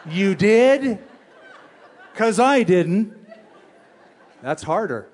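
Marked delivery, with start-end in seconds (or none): 0.0-3.2 loud, high, hammed-up resentment; envious disbelief. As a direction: protest the unfairness in mock indignation. Acted envious reply to coworker's raise.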